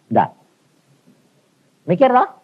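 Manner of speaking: normal talking voice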